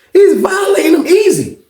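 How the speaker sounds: high squeal through laughter; bursting out laughing mid-story. Real, not acted. Telling friends about the cellmate, laughing high and loud.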